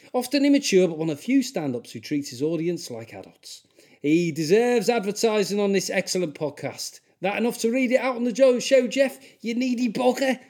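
high, loud put-on Scouse; Scouse accent. Comic reading a listener's praise in a high, put-on Scouse accent. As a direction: push the Scouse up high and lively. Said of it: "I'm gonna do a Scouse accent".

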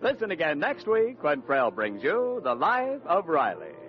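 usual speaking voice

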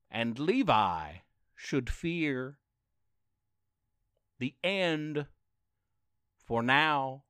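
slow, measured storybook reading; story narration. A narrator's reading. A dad ends the story rhyme, slow and measured. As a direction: read the closing rhyme like a storyteller.

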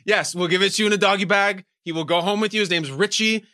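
normal talking voice

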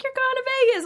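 high, loud mock squeal; excited announcement, imitated. Judges telling dancer she made it, imitated excited squeal. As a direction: announce the big win in a squeaky retold voice.

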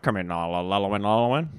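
low, muffled radio call; ham radio voice. Acted. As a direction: talk like over a CB radio. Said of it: "you dropped your ham radio voice".

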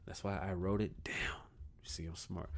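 whispered aside. Real, not acted. Vlogger whispering an aside about his notes. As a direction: let it slip out as a secret.

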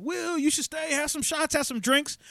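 high-pitched imitation of eager friends; coaxing party crowd. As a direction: coax him in a high voice. Mimics crowd coaxing him.

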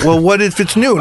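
high, eager question; pressing like a curious child; excited kid rambling. Retold or imitated.

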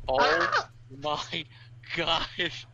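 breathless oh my gosh; shocked gasp. Real, not acted. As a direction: caught off guard by the bite. Gasp at the bite.

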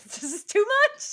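high, loud overwhelmed cry. Retold or imitated. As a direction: squeal it like it's all too much. Overwhelmed self at fireworks acted.

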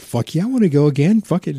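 hammed-up eagerness to go again; eager yes.

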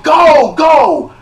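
high, loud impatient yell; furious shout at the stalled car; angry shouting. Retold or imitated. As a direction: yell at the driver ahead to move. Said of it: "I'm so mad".

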